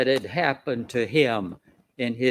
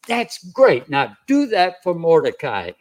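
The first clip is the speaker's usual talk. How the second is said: delighted royal command; 3 semitones higher, 4 dB louder than usual. Acted. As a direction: exclaim approval, then give the order. Voices king's delighted command.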